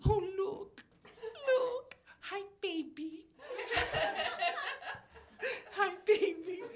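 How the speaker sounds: put-on high, soft old lady baby talk; cooing grandma. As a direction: coo at the baby. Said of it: "she'd go".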